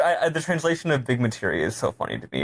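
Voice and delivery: ordinary talk, nothing marked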